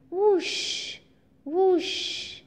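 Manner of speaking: a breathy whoosh like blowing into a bottle; whooshing sound. Shown as a demonstration. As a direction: show the children the bottle's whoosh. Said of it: "Can you hear a whooshing sound?".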